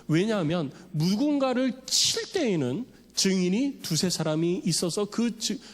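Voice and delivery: ordinary talk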